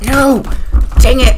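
loud, high yell of real frustration; frustrated shout. Real, not acted. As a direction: yell it like you just lost. Died in game.

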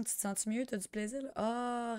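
ordinary talk, nothing marked

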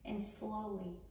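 unhurried instructions, leaving room to breathe; guided meditation. Acted. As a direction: guide a panicked listener calmly through each breath.

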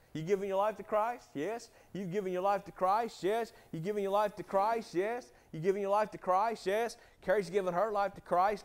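usual speaking voice

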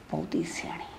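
whispered sneer under her breath; mother sneers about her daughter-in-law in a whisper; scornful whisper. Acted. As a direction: mutter it to herself with contempt. Mother mutters scornfully to herself.

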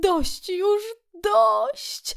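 very high, rapturous protest; delighted cry. A narrator's reading. Kret overwhelmed by the list of food, delighted cry. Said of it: "wołał zachwycony Kret".

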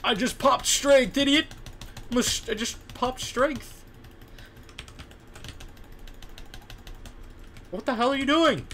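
loud, high exasperated yell; angry yelling. Real, not acted. Gamer yelling at a teammate who messed up, loud angry. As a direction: chew out the idiot teammate.

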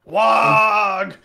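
loud, gruff orc cry; orc bark. Pretend. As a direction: shout it like an orc. Shouted orc word.

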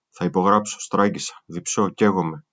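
ordinary delivery, nothing marked

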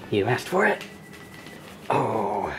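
normal talking voice

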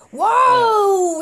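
loud, high startled whoa. Acted. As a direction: yelp it as the dog jumps on you. [name] jumped on by the dog.

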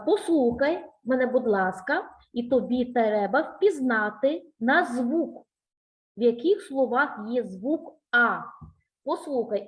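ordinary delivery

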